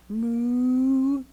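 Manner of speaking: a lightly voiced cow's moo; just moo like a cow. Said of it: "And that meant".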